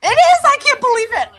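very high thrilled squeal; excited squeal. Real, not acted. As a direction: squeal with delight, voice way up. Fan gushing over show revival.

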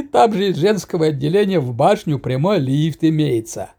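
words through a giggle; laughing while talking. A narrator's reading. Kuzya laughing through line.

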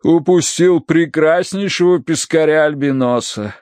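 complaining. A narrator's reading. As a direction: lament the one that got away. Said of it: "пожаловался профессор". (жалобно, с растяжкой)

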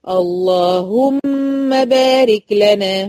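اللهم drawn out slowly with a thick lam; heavy lam. Shown as a demonstration. Teacher showing students the heavy lam of اللهم. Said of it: "يبقى هتفخم".

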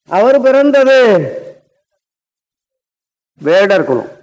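ordinary delivery

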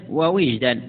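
ووجدا with a short i, "wa-wijdan"; second waw of ووجدا opens on "wi"; i vowel on waw. Shown as a demonstration. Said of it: "مثلث الواو".

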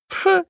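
short, high-pitched "pf!" of derision; dismissive snort. A narrator's reading. Heroine scoffing at her friend's jealousy theory, a dismissive snort. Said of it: "фыркнула в ответ".

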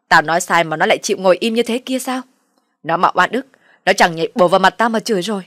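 loud, indignant parental tirade; angry berating. A narrator's reading. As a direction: shout down the son and threaten. Parent rages back in family quarrel.

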